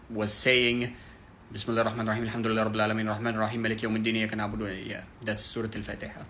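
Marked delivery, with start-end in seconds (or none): 1.5-4.9 hurried recitation, barely stopping between verses; very fast monotone. Shown as a demonstration. Teacher showing how he used to recite, very fast. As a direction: rattle off the verses in a monotone rush. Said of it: "I was actually reading it that fast".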